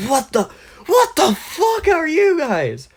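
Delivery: very high, loud, stretched squawk; shocked outburst. Acted. Bakugo sees summoned Avatars appear, shocked high shriek. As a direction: break off, then shriek it in mock shock.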